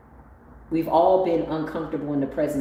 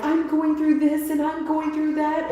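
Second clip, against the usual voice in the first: whiny complainer; 11 semitones higher than usual. Retold or imitated. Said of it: "they're like".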